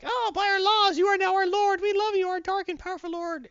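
very high, loud, squeaky worshipper voice; worshipping subjects. Retold or imitated. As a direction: hail your new dark lord in a shrill fanatical squeak. Wakandans hailing their new lord.

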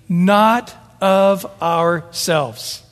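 "Not of ourselves" drawn out very slowly, with a hard stress on "Not"; very emphatic. Acted. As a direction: lean heavily on "Not" and let "of ourselves" follow slowly. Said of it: "it says very emphatically".

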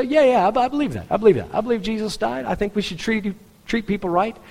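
high, mocking imitation of lip service; dismissive believer. Mimicking dismissive professing believer.

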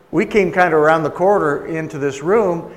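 ordinary delivery

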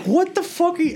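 very high-pitched retold disbelief; incredulous. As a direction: squeal it in mock bewilderment. Retelling his baffled reaction to threat.

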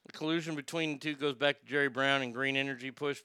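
ordinary talk, nothing marked

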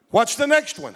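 ordinary talk, nothing marked

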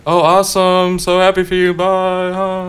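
high, loud fake happy gush; fake cheerful. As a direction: sound thrilled but fake. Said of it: "pretending ... that they're happy".

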